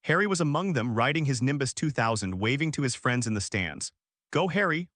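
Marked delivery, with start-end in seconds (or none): 4.3-4.9 higher-pitched cheer, only lightly raised; mild narrated shout. A narrator's reading. Ron cheers Harry on from the stands, a mild shout. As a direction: call out a restrained cheer in narration. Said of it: "Ron shouted".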